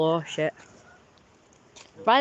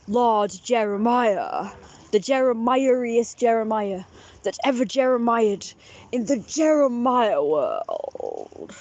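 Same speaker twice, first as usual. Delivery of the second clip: slow, grandiose proclamation with drawn-out names; hammed-up almighty ruler, stretching the words; supreme ruler; slower than usual. Proclaiming own titles as sun god, slow grandiose ruler. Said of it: "the supreme ruler of the universe talks like this".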